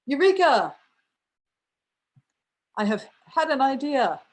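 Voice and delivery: high, triumphant mock discovery; sudden eureka. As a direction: cry out as if the idea just struck. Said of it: "suddenly thought".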